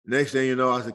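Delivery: normal talking voice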